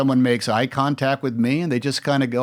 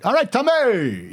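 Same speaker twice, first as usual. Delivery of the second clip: passerby greeting; 12 semitones higher, 3 dB louder, slower than usual. Retold or imitated.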